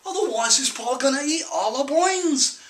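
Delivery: put-on high, loud, urgent rural-accented warning; frantic pleading. Son begs parents to fight the zombie, frantically. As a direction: beg them to believe you, pitched right up.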